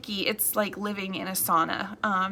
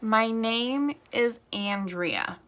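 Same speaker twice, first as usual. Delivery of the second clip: slow, deliberate name; much slower than usual. Shown as a demonstration. Realtor slowing down so viewers catch her name. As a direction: say your name slowly and clearly. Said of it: "they don't hear my name".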